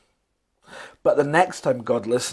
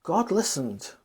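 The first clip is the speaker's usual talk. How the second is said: ordinary delivery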